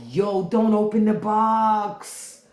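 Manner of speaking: slow, urgent warning; pleading with the screen. Retold or imitated. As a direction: beg the character not to. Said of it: "I was like".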